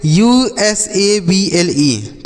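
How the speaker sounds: letters of usable spelled out slowly, one by one. Shown as a demonstration. Teacher spelling a word for children, slowly letter by letter. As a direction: read out यूएसएबीएलई as separate letters, slowly. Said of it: "तो इसे हम पढ़ते हैं".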